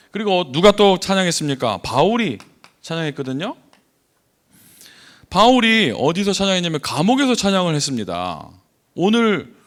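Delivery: loud, emphatic sermon delivery; forceful preaching. Real, not acted. Preacher urges the congregation on praising God, in forceful preaching style. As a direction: drive the key phrases up high and hard.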